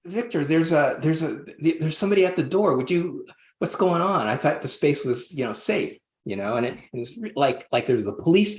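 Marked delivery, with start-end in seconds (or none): 0.1-4.3 higher-pitched, alarmed calling out to a friend; retold panic. Man at ceremony calls to friend Victor about door knock, mock alarmed. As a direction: replay the moment you heard the knocking, a little frantic. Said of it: "And I go, I go".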